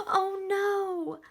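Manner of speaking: loud, high dismayed cry; alarmed. Acted. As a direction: gasp it out like bad news. Story alarm, reading to kids.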